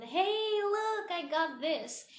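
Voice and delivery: put-on high, sing-song kid showing off a prize; eager child. A child running to mom and dad with a trophy, high and sing-song. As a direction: chirp it up like a proud little kid.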